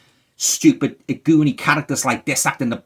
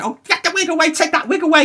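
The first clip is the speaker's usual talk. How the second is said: very high hammed-up shriek; a scared ghost hunter shrieking at a wig, very high; mock shriek; 4 dB louder than usual. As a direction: squeal it like a terrified buffoon. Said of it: "and he said".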